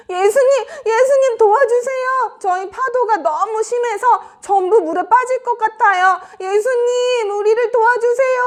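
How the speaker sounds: high, urgent pleading calls; shouting for help. Acted. Disciples begging Jesus to save them, high urgent shouting. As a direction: call desperately across the water. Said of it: "도와달라고 소리를 치고".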